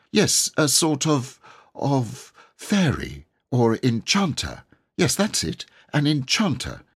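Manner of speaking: lightly voiced boy rattling off his story; boy Robert, eager and breathless. A narrator's reading. Robert telling the knight his tale, eager boyish voice.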